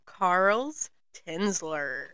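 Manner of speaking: slow hushed host voice, luring listeners in; podcast intro. Acted. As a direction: lure them in like a storybook witch.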